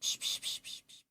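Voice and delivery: unvoiced, fading psh sounds; quiet whispered psh noises; imitated hissing. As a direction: breathe out soft hisses like a pretentious singer. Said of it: "the lead singer would go".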